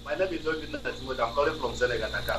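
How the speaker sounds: faint, far-off phone voice; very soft and hard to hear; barely audible. Real, not acted. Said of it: "please raise your voice".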